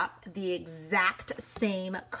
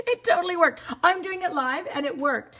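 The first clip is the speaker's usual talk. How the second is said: higher and louder with triumph; excited; 8 semitones higher, 5 dB louder than usual. Real, not acted. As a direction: celebrate that it worked. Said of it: "I'm super excited".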